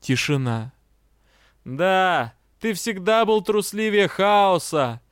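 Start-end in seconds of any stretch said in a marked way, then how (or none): 1.6-5.0 slow, mocking jeer; taunting. A narrator's reading. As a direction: goad it with a sneer. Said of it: "решил перейти к насмешкам".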